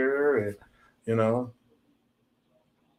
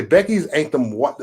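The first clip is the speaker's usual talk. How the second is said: normal talking voice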